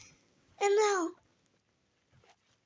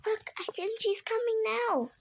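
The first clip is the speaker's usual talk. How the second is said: high, anticipating kitten voice; high-pitched excited anticipation; excited little cat; 9 semitones higher than usual. Acted. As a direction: point out the arrival, pitched way up. Little cat spots the queen coming.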